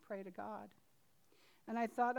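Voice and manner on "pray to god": a quieter voice from inside the chest; softly repeated inner command. Retold or imitated. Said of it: "except it didn't come from my throat".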